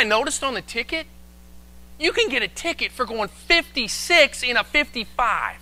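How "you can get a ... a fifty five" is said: high-pitched disbelief; incredulous. Retold or imitated. Pastor discovers one mile over earns a ticket, incredulous. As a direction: act out amazed indignation, pitched well up. Retelling shock at ticket rule.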